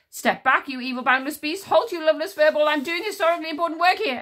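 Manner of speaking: high, loud, fast panicked yell; shrieking indignant official; frantic shouting. Acted. Commander yells at werewolf.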